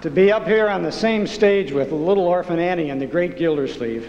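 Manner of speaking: usual speaking voice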